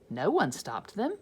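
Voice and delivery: plain reading voice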